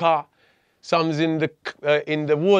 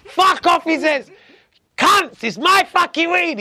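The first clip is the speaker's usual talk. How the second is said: imitating dad's furious shout, much higher and louder; angry dad yelling at raiding officers, high and loud; dad going crazy; 13 semitones higher, 7 dB louder, slower than usual. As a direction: bellow it like a dad losing it at the police. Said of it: "My dad's going crazy at them".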